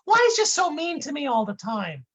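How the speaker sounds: high, dim-witted sidekick explaining things; dopey Dummerton voice. Acted. Dummerton lecturing his partner Brick onstage, high dopey voice.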